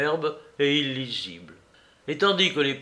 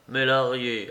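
usual speaking voice

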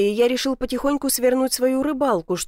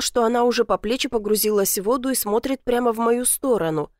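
plain reading voice

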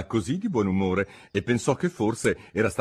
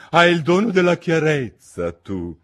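higher, louder, pompous duchess; 8 semitones higher, 7 dB louder than usual. A narrator's reading. Duchess lectures Alice, pompous and higher. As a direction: preach a moral she cannot recall.

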